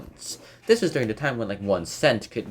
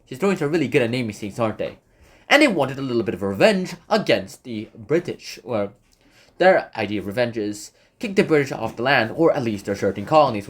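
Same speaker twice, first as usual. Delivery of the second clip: usual speaking voice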